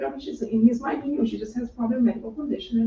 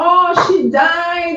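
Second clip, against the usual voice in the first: loud, high, drawn-out lament; mock despairing wail; 8 semitones higher, 9 dB louder, much slower than usual. Mourners crying to Jesus over the girl's death, mock despairing wail. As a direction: wail it slowly, imitating grief-stricken mourners. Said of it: "they're crying, this despair".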